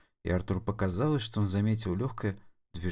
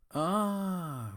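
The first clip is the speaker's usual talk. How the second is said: high drawn-out realizing ah; sudden realization; 11 semitones higher, 3 dB quieter than usual. Acted. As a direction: realize it all at once. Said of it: "вдруг понял он".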